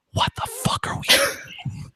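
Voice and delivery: slow, high whispered aside. Retold or imitated. Whispering to Natalie during the charity scene. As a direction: hiss it quietly to your friend. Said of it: "and she went".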